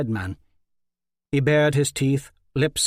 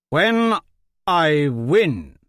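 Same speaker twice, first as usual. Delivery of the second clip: louder, insistent call; demanding shout; 3 semitones higher, 5 dB louder, much slower than usual. A narrator's reading. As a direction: shout it over a roaring crowd. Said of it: "Hawkwood demanded over the roar".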